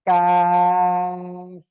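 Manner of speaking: কাফ drawn out very long and loud; teacher models a long kaf; Arabic kaf demo. Shown as a demonstration. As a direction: stretch the vowel in কাফ for four alif. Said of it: "এটাই হবে".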